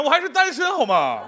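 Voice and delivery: ordinary talk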